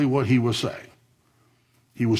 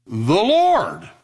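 loud, higher and held out; The Lord rung out to the hall; proclaiming; 8 semitones higher, 4 dB louder than usual. Acted. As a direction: announce it like a herald. Preacher answers his own question.

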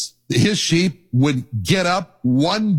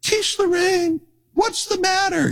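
sweet-voiced wife. Wife soothing her crying goat at the door, high tender coo. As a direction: coo to her like a gentle mother. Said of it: "she just would say".

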